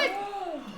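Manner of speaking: shocked gasp. Acted. Accused of betraying his clan.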